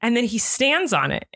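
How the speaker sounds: ordinary talk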